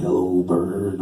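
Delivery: very low, drawn-out creature greeting; deep lumbering puppet voice; Snuffleupagus. Retold or imitated. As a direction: say it deep and dopey, like a big sluggish puppet. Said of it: "he was like".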